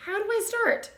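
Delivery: high, eager piglet asking; a little pig begging for the first step; young pig voice. A narrator's reading. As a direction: Wilbur asks Charlotte how to begin, high and eager. Wilbur asks Charlotte for coaching.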